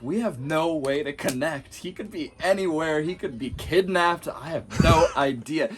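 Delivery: recalling the lost friend, mock-frantic; retold panic. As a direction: replay the panic with a laugh in it. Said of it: "I kinda start panicking".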